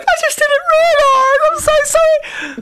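high, slow, fake sobbing mum; mock crying mum. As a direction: whimper the apology up high. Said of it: "Mummy will be on the sofa crying".

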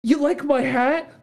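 light up as if flattered; delighted surprise. Retold or imitated. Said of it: "And he's like".

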